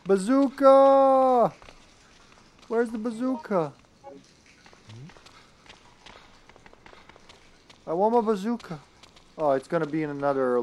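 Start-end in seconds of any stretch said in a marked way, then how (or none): 0.1-1.5 long, high, loud holler; drawn-out playful yell; calling out. Pretend. Calling out for the missing weapon. 2.7-8.8 high-pitched mock pleading; plaintive, put-on high whimper; playful whining. Playful whining for missing weapon.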